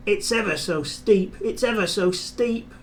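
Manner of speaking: a straining engine puffing up a hill; puffing James. A narrator's reading. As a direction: chug it out as you climb. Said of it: "puffed James".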